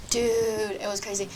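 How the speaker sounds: ordinary delivery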